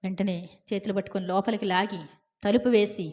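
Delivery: usual speaking voice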